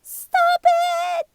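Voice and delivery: very high, loud squeaky shout; squeaky cry. A narrator's reading. Little Nagiri stopping the fight, squeaky high shout. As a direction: squeak it out, tiny and desperate. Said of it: "in a squeaky voice".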